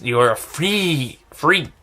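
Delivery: slow, stretched-out attempt at a bushy voice; rough attempt at someone's odd voice; bushy character voice. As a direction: imitate an odd character, dragging the insult out. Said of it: "has this kind of a very bushy voice".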